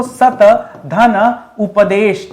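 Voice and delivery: सत धन उपदेश drawn out very slowly, word by word; very slow breakdown. Shown as a demonstration. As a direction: crawl through सत धन उपदेश, pausing after each part. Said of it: "किससे बना दोस्तों". (सत धन उपदेश बहुत धीरे, हर शब्द अलग-अलग)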